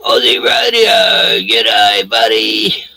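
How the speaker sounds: calling out to a mate; loud hearty greeting. Real, not acted. Host greeting Aussie Radio, loudly called out. Hails an arriving listener.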